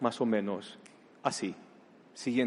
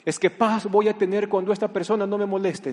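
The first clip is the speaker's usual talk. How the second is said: mock complaint; 7 semitones higher, 4 dB louder than usual. Imita al creyente quejoso.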